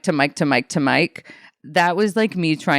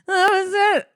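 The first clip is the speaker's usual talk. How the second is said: high-pitched, drawn-out mock crying; mock tearful. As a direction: imitate your own overdramatic sob of triumph. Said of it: "I, like, cried".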